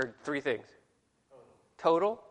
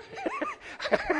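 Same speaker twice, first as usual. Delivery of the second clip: laugh; 3 dB quieter than usual. Real, not acted. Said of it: "Oh, Hebrew".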